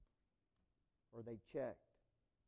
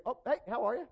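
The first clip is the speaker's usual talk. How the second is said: caught off guard; 7 semitones higher, 7 dB louder than usual. Retold or imitated. Caught in a dark alley.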